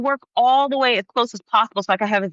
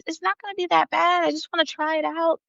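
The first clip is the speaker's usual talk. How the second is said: reassuring parents; 10 semitones higher, 3 dB quieter than usual. Retold or imitated. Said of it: "And I just said".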